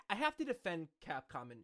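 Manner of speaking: ordinary talk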